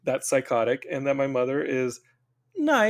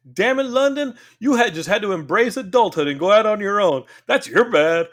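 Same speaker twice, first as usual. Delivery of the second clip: mock scolding; 5 semitones higher, 4 dB louder than usual. Mocking the friend's voice.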